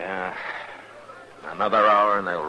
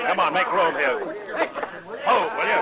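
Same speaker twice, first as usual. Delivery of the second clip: higher, raised call to push through the crowd; 9 semitones higher than usual. Acted. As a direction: clear the way, sharp and commanding. Marshal pushes through crowd.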